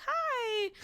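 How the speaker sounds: high, drawn-out phony hello; put-on high-pitched sweetness; fake friendly greeting. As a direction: mimic a fake, sing-song greeting. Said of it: "I knew it was fake".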